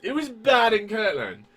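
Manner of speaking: high, loud, shouting disbelief; incredulous rant. Real, not acted. Ranting to cohost about Joseph Smith, loud incredulous shouting. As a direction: rant at the top of the voice, stretched out.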